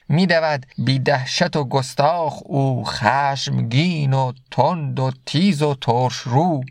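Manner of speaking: slow, measured reading of Rumi's verse; slow verse recitation. Acted. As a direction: recite the couplet slowly, letting each word land. Recites Masnavi verse slowly. (شعرخوانی آرام و شمرده)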